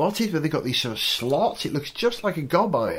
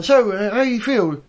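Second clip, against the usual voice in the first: higher, louder cheery question; upbeat interviewer; 6 semitones higher, 4 dB louder than usual. Retold or imitated. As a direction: ask brightly like a reporter. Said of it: "when he was questioned".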